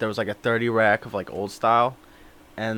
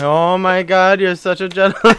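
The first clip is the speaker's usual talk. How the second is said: louder, higher hammed-up gushing; mock gratitude; 6 semitones higher, 5 dB louder than usual. Prankster doing the same thanks for caller, mock gratitude. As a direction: gush in a raised put-on voice.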